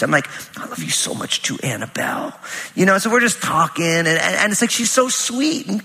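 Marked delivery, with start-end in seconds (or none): 0.6-2.3 whispered, much lower and softer; whispering back to his daughter on the couch; tender whisper. Retold or imitated. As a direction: hush it lovingly to a little girl. Dad answers daughter tenderly.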